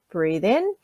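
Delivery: soft, slow cue to breathe in; guided breathing cue. Shown as a demonstration. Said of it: "you're gonna breathe in".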